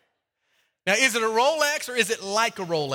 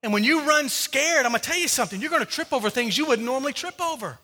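loud, high, driving sermon voice; full-force preaching; 6 semitones higher, 4 dB louder than usual. Acted. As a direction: preach it hard to the crowd.

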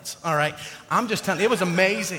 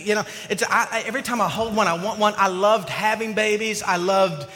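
ordinary delivery

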